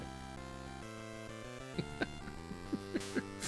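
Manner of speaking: soft, wheezing laughter; helpless laugh. Real, not acted. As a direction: dissolve into quiet laughter. Laughing at failure.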